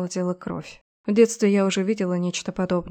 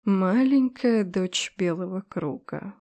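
slow hypnotic serpent hiss; slower than usual. Acted. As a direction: draw each word out like a coiling snake. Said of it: "прошипела змея-богиня".

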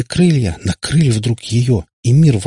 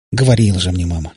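usual speaking voice

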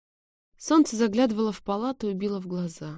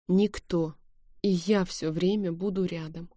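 plain reading voice

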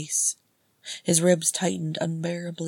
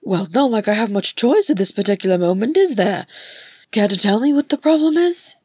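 higher, loud, exasperated complaint; loud protest; 5 semitones higher, 10 dB louder than usual. Acted. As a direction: protest while being dragged along. Dragged brother complains.

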